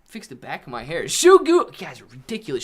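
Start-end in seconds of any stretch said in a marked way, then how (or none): 1.1-1.7 excited call. Real, not acted. Calling out donor again.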